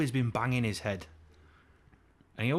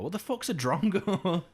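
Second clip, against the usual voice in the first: higher, incredulous blurt; baffled outburst; 9 semitones higher, 4 dB louder than usual. Acted. Puzzled by Australian insults, baffled outburst. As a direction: react in disbelief to baffling slang.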